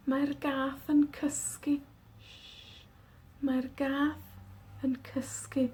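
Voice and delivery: slow whisper so as not to wake the cat; shh, the cat is sleeping, whisper it. Shown as a demonstration. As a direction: breathe the words softly and slowly.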